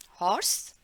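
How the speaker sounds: usual speaking voice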